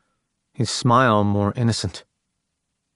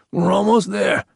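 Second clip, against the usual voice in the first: croaking; 9 semitones higher than usual. A narrator's reading. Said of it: "he croaked".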